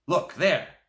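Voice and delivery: loud, high call, pointing at the page. A narrator's reading. Grandpa points boy to the newspaper, loud call. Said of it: "he said in a loud voice".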